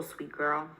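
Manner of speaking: ordinary talk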